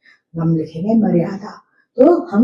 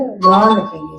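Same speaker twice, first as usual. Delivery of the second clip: ordinary talk, nothing marked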